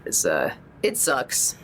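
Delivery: usual speaking voice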